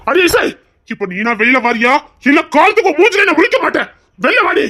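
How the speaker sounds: bellowing at the house; shouting for her. Acted. Husband screaming for his wife to come out. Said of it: "நீ கத்தியுமே அவ வரல".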